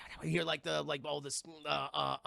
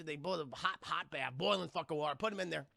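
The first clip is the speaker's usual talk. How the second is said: usual speaking voice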